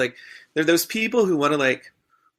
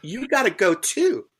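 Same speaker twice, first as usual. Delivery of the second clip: higher, sassy drag-queen scolding; sassy telling-off; 4 semitones higher than usual. Retold or imitated. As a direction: read him like a diva dismissing a fool. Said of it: "I was like".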